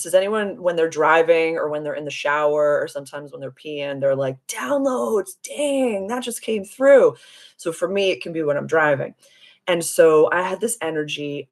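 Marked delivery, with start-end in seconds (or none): 4.5-7.1 drawn-out, high-pitched mock amazement; excited exclamation. Streamer acting out getting downloads while driving, excited. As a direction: exclaim it high, stretching the words out.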